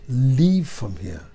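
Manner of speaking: loud furious yell at the door; angry shouting. A narrator's reading. Daughter yelling at the rabbi to leave, furious shout. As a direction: shout her away in fury. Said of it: "really shouts at him".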